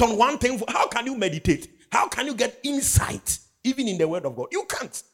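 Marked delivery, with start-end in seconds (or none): none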